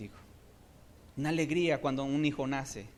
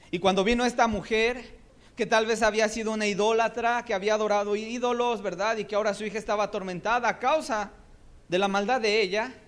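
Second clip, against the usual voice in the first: raised, loud preaching, driving on; fiery preaching; 7 semitones higher, 6 dB louder than usual. Acted. Preacher telling the church about the idolatrous woman, in loud preaching. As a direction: preach it hard and build.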